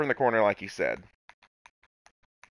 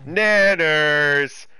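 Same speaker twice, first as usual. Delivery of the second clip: louder, excited call; 4 semitones higher, 9 dB louder than usual. Real, not acted. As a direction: shout it with glee.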